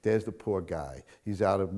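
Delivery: usual speaking voice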